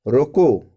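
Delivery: loud, falling रोको, then stop; रोको on its own. Shown as a demonstration. Teacher isolates the word before the comma, रोको loud and final. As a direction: land firmly on रोको and stop. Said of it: "जैसे देख रहे हैं".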